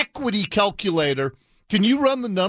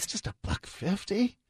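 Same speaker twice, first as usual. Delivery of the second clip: mock hushed disbelief; 2 semitones higher, 10 dB quieter than usual. Sarcastically echoing the clip's price.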